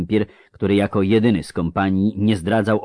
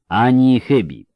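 raised, mocking agreement; sarcastic; 5 semitones higher, 7 dB louder than usual. A narrator's reading. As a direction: agree with a sneer in the voice. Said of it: "rzekł z przekąsem Kahir". (z przekąsem)

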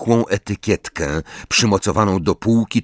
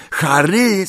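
calling his name, raised pitch; 11 semitones higher than usual. A narrator's reading. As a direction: call out to a friend. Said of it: "zawołał Ron".

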